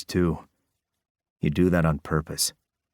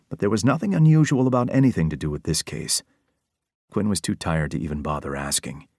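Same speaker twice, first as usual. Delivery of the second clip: ordinary delivery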